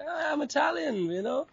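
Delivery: high, breezy reply; playful answer. Retold or imitated. Answering guests who don't know him, a high, breezy reply. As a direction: toss it off lightly.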